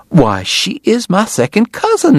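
high, drawn-out Southern delight; thrilled cry. A narrator's reading. Missus Segar discovers a shared acquaintance, thrilled. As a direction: exclaim it slowly, pitched far up. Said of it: "Missus Segar cried".

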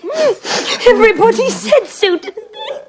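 breaking into tearful sobs, voice jumping up; weeping through the memory of her marriage; crying. Acted. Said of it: "I haven't cried since I was fourteen".

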